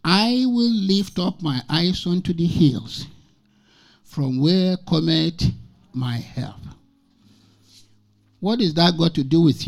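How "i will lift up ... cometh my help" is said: scripture read very slowly, word by word; slow, weighty reading of the Psalm line; slow, deliberate reading. Shown as a demonstration. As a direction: draw each word out so they take it in. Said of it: "I want you to note that very carefully".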